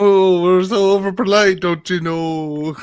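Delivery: high mock-Midwestern lilt, slowing at the end; over-polite Midwestern accent. Playing along about over-polite locals, Midwestern accent. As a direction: put on a sugary Midwestern accent. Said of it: "stupid Midwestern goofy accent".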